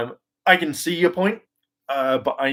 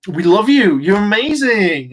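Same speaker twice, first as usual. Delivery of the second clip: louder, higher adoring gush, slowing down; gushing fans; 8 semitones higher, 4 dB louder than usual. Pretend. Fans gushing loudly at the new ranger. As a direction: gush like a starstruck fan. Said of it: "they'd all be stuff like".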